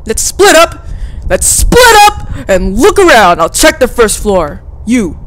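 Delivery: high, loud acted call; eager character. Game character urging group to split up, high loud call. Voicing character's urgent plan.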